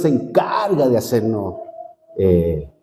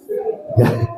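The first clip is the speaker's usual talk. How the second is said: short high unvoiced laugh; a quick breathy chuckle; 3 dB quieter than usual. Real, not acted. Preacher laughing at his own road rage.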